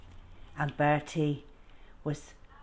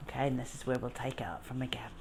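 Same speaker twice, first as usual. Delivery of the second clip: fast hushed aside; rushed whispered aside; quick whisper; 4 semitones lower, 9 dB quieter, much faster than usual. Real, not acted. Hushed aside before reading.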